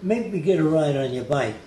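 lightly voiced Italian-American street kid; a hint of a New York Italian kid calling out; mild Italian-American accent, tossed off. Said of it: "The Italian kids spoke like this".

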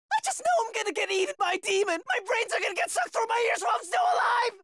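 fast panicked babble; frantic fearful rant. Acted. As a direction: rush the words in blind terror. Frantic fear of demons.

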